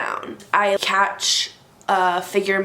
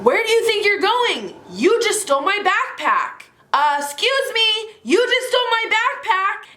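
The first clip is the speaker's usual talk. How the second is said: yelling; 13 semitones higher than usual. Retold or imitated. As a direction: call out the thief across the parking lot. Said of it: "I start yelling".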